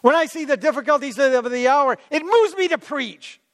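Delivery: impassioned, pitch climbing to the end; angry zeal. Acted. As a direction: preach it with rising righteous fervor. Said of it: "he became angry and zealous".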